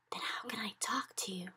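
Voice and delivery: soft breathy whisper. Acted. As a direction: whisper it helplessly. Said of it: "Sophie whispered".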